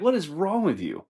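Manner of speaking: high, swooping exasperation; acted disbelief, pitch leaping up; incredulous reproach. Waiter confronting a bad parent, mock outrage.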